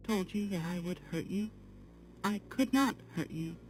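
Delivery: ordinary talk, nothing marked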